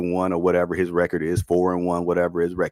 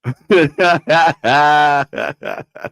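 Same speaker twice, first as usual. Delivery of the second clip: loud laugh; 6 semitones higher, 7 dB louder than usual. Real, not acted. Laughs after ranting about turnovers.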